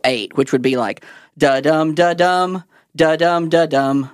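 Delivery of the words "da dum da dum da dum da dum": da-DUM beat, each "dum" leaned on; "dum" stressed after a light "da"; iambic rhythm demo. Shown as a demonstration. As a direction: chant "da-dum" in even weak-strong pairs. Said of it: "iambic tetrameter is sets of four".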